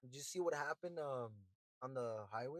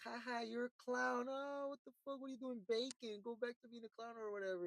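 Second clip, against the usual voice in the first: mocking laugh; 7 semitones higher, 4 dB quieter than usual. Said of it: "And they're like".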